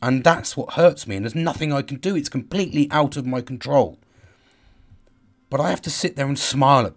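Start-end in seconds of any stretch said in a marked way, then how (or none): none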